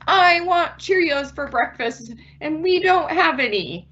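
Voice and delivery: put-on high childish complaining voice; high-pitched whiny kid. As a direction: whine like a kid denied cereal. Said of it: "So your child says".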